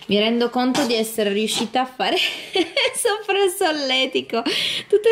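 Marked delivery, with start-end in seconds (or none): 2.2-2.9 high giggle. Real, not acted. Laughing at tickling.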